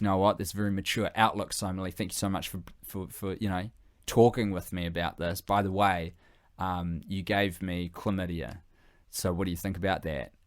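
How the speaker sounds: soft, low, measured thanks; understated reply. Acted. Zaccoli answering Somali in a sit-down chat, understated reply. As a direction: acknowledge it quietly, in an understated way.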